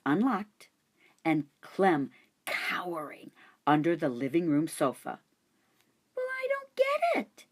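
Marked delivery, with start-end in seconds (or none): 6.2-7.5 put-on much higher, puzzled girl voice; a confused little girl; young girl voice. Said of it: "said Miranda".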